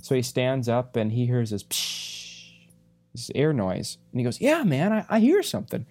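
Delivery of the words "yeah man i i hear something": excited. Retold or imitated. Friend excitedly hears the noise.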